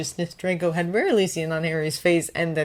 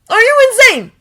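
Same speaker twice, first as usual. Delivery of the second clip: high, loud snapping; angry snap; 14 dB louder than usual. A narrator's reading. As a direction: snap back in disbelief. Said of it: "Draco snapped".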